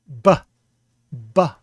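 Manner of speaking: "ba" com lábios explodindo, bem alto; plosive b, bursting. Shown as a demonstration. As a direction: estoure o b em "ba". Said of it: "with your lips bursting open".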